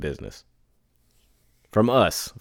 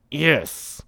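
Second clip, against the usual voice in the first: forced yes; 8 semitones higher than usual. Retold or imitated. Reluctant yes.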